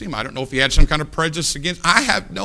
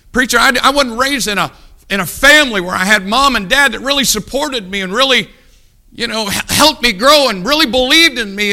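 mock complainer; 6 semitones higher, 5 dB louder than usual.

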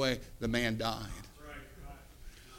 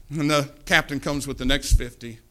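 ordinary talk, nothing marked

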